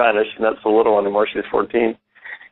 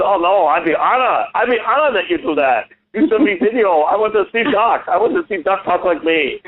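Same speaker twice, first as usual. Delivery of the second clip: much higher, eager foreign guide voice; an honoured guide asking for video; imitating the tour guide; 9 semitones higher than usual. Chinese tour guide thanks the puppeteer, much higher eager voice. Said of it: "I was imitating him when he talked".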